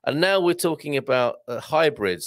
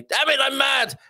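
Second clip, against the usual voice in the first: "Damn it, I'm mad!" blurted high in mock anger; mock mad outburst; 12 semitones higher than usual. Host reading a comedian's joke, mock angry. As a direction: play the cross comic, much higher. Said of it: "Dimitri Martin quote of the day".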